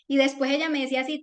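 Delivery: ordinary delivery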